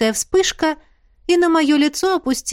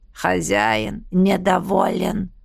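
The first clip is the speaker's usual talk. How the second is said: slow grating sinister voice; creaky unpleasant voice; 4 semitones lower, much slower than usual. Acted. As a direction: rasp it out like a menacing stranger. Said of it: "скрипучий неприятный голос".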